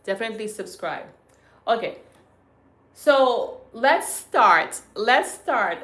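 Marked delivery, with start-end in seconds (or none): 2.9-5.8 loud, very slow drawn-out start call; announcing start. Real, not acted. Host opening the livestream, loud drawn-out call. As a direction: kick off the show with a big call.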